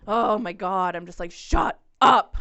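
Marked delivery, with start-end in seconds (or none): 1.3-2.4 loud retold yell of exasperation; exasperated. Reacting to the nonstop gym talker, exasperated yell. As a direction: punch each word out loud.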